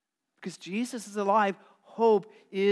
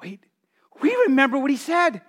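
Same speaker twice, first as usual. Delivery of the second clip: dawning realization; 6 semitones higher, 4 dB louder than usual. Acted. Women realizing, acted quote.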